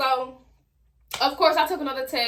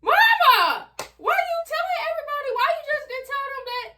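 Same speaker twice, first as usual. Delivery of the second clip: whiny complaint; 10 semitones higher than usual. Retold or imitated. Embarrassed teen whining at her mama for telling everybody, very high. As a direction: whine at mama, pitch way up.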